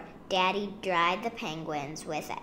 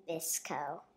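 usual speaking voice

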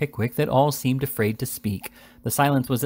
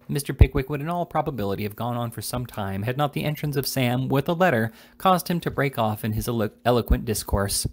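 usual speaking voice

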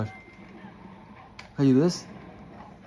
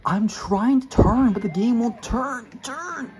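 loud, slow, exasperated complaint; frustrated yelling; 8 semitones higher, 4 dB louder, slower than usual. Real, not acted. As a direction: yell at the game in frustration. Frustrated at game controls.

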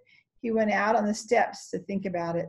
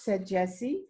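ordinary delivery

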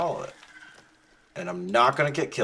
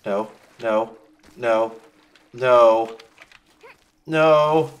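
panicked no; 10 dB louder, much slower than usual. Real, not acted. In danger, rising to shout.

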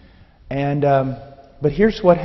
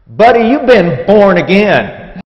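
booming, slow, higher-pitched Texan; big Texan; 5 semitones higher, 10 dB louder, slower than usual. Retold or imitated. Big Texan slapping a new convert's back, booming. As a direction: boom it out like a big hearty Texan. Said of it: "you know, big Texan".

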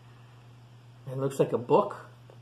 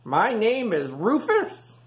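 higher, slow proud announcement; eager young pig; 9 semitones higher, 6 dB louder, slower than usual. Acted. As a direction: announce yourself grandly. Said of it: "said Rufus".

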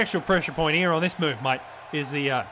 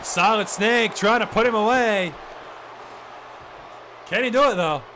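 building excitement; 5 semitones higher than usual. Acted. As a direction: push the suspense up. Near-fall build in commentary.

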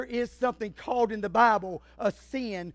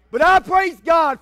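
loud preaching praise; 7 semitones higher, 13 dB louder than usual. Acted. Preaching surge.

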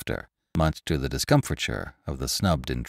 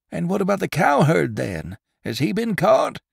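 much higher, eager rushed question; excited; 12 semitones higher than usual. Acted. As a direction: burst in, hungry for news. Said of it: "inquired the bootmaker excitedly".

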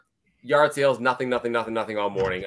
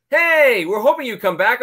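calling out; 10 semitones higher, 6 dB louder than usual. Retold or imitated. Husband calling out in retold scene.

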